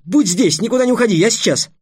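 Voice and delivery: high, loud, hurried excitement; urgent call. Acted. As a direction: call it out urgently before running off. Trix rushes off for food. (взволнованно, громко, высоким голосом)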